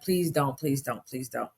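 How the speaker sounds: ordinary delivery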